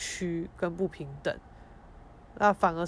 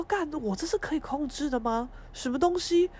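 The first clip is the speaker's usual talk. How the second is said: exasperated rant; 7 semitones higher than usual. Retold or imitated. Inner rant about crying.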